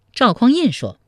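ordinary delivery

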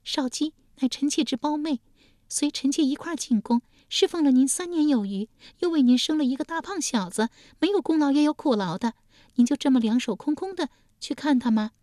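high, coaxing consort; high concubine wheedling; court consort. Acted. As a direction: nudge him in a high voice. Consort coaxes duke.

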